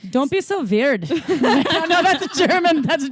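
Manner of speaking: put-on muddled German accent. Host redoes a requested accent, shaky German with Irish creeping in. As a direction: attempt a German accent, slipping toward Irish. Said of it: "manifesting a positive German accent right now".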